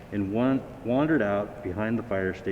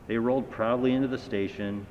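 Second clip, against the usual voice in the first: ordinary delivery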